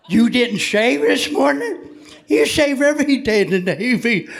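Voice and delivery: high-pitched, incredulous dressing-down; indignant officer. Retold or imitated. Officer berates recruit in retold inspection.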